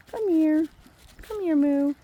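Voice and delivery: coaxing call. Real, not acted. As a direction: lure the cow over with a bright call. Calling the cow.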